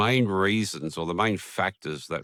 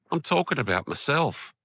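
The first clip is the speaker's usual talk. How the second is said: high, amused self-catch; catching himself mid-complaint, amused realization; sudden realization; 9 semitones higher than usual. Retold or imitated. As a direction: laugh at yourself as it dawns on you. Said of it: "And then I went".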